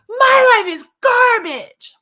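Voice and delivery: high, loud yell with drawn-out words. Retold or imitated. Angry woman venting at the lake, yelling. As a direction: yell it at the sky, slow and high. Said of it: "I'm yelling".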